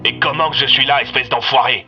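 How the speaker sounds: inspector's heated insult; angry retort. Acted. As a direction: snarl back at the enemy. Inspector insults villain over radio.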